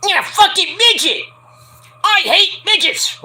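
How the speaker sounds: high, slow, put-on yell; hammed-up high brawler voice; mock yell. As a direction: ham up a brash tough guy yelling. Mock-voicing a wrestler's yell.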